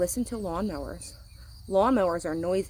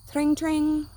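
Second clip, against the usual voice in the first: high, louder tring; bicycle bell; 9 semitones higher, 5 dB louder than usual. A narrator's reading. Reading to children, high bell tring. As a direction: ring it out brightly like a bell. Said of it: "A bicycle bell ring".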